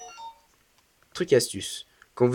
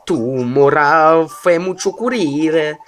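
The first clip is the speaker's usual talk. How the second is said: character voice; 3 semitones higher, 4 dB louder, slower than usual. Acted. Voicing an angry game character.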